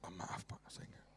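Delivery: whispered apologetic refusal; sheepish whisper; meek whispered excuse. Retold or imitated. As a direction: breathe it out, embarrassed to decline.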